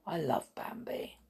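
breathy whispered aside. Real, not acted. Whispering to listeners about loving Bambi. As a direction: hush it like a secret.

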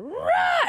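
high mock tantrum roar. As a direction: let out a mock rage yell. Acting out tantrum with daughter.

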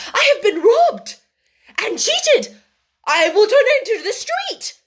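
high, loud cry of outrage; furious outburst. A narrator's reading. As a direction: rage at being robbed, loud and high. Furious woman in an acted quarrel.

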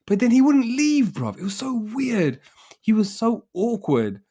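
high, disbelieving retelling; can't believe how awkward he was; incredulous. Real, not acted.

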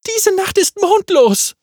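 hoch und laut ausgerufen; laut gerufen. Acted. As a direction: ruf es theatralisch in die Nacht. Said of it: "rief Magga". (Magga ruft laut und viel höher)

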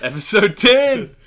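loud, high show-opening shout; hyped announcement. Real, not acted. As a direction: call out the episode number like a hype man. Hyped podcast intro call-out.

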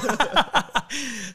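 high-pitched laugh; high burst of laughter. Real, not acted. Laughs at oatmeal joke.

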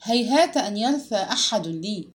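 usual speaking voice

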